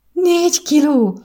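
high, loud disbelieving cry; a mother stunned by the baby's weight; shocked cry. A narrator's reading. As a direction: gasp it out in sheer disbelief. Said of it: "kiáltott fel az anyuka".

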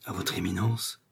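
soft, dazed murmured question; hushed echo to himself; bewildered murmur. A narrator's reading. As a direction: murmur it, lost and disbelieving. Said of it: "murmurait Bonacieux en roulant des yeux égarés".

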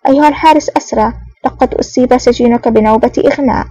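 ordinary delivery, nothing marked

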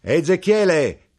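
slow, loud, high call; knife-grinder calling to the man at the table; called name. Acted. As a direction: draw out the name to reach him. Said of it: "chiamò l'arrotino".

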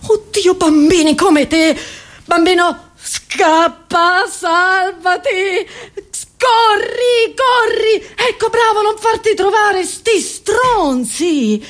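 high-pitched, hammed-up alarm; high, desperate warning cry; frantic urging. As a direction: cry out to a child to run for it. Urging child workers to flee.

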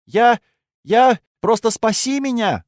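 high, loud desperate cry; desperate scream. A narrator's reading. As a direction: scream it out in despair. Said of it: "закричала она в отчаянии".

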